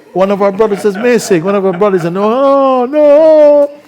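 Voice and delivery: high, loud alarm at a missing brother; alarmed cry. Acted. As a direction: cry out in comic horror, rising to a shriek on no. Fools discover a missing brother.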